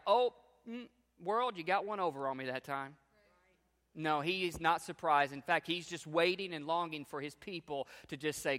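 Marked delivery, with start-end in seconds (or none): 0.1-3.0 mock flustered God. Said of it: "God is not like".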